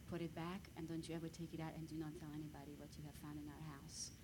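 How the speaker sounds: normal talking voice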